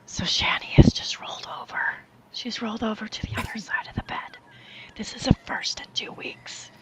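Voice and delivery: hushed ASMR whisper narration. Pretend. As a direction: whisper it like a nature documentary. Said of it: "A S M R Shanny reviews".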